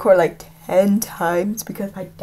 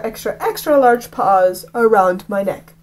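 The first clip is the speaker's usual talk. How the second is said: ordinary delivery, nothing marked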